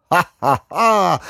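high, derisive ha-ha-ha; acted scornful villain's laugh; mocking laugh. A narrator's reading. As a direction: laugh it out in mockery. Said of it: "zaśmiał szyderczo".

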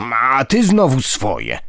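slow, abashed, embarrassed grumble; a caught-out old ghost fumbling a reply; flustered grandpa. A narrator's reading. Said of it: "zmieszał się dziadek". (zmieszany, wolny pomruk dziadka)

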